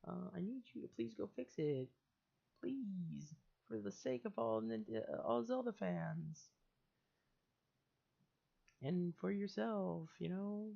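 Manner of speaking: imitating pleading bro. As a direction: beg him like a fellow fan. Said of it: "supposed to be like".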